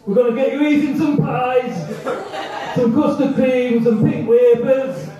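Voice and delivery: loud, high comic motivator; Johnny Vegas. Acted. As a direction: shout encouragement like an overexcited trainer. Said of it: "Johnny Vegas".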